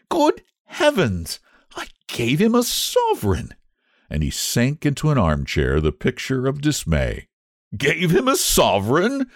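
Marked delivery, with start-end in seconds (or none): 0.1-3.5 put-on a young man's aghast cry; cried out. A narrator's reading. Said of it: "cried Huey". 7.8-9.3 shouted. A narrator's reading. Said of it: "shouted Trevor as he burst into a roar of laughter".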